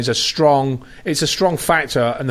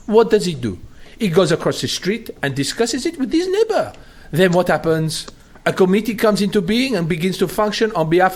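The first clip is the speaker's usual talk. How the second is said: put-on playful French accent, pitched higher; 5 semitones higher than usual. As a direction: quote Tocqueville in a French accent. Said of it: "so I'm going to do a French accent".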